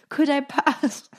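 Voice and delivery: slow, put-on British accent; mock British accent. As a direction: try to pass as British, laughing at yourself. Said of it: "That was my terrible British accent".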